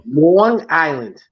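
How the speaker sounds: "Long Island" slowly drawn out; slow teaching model of "Long Island"; Long Island pronunciation. Shown as a demonstration. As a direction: stretch the vowel of "Long" in "Long Island". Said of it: "There you go. You got it".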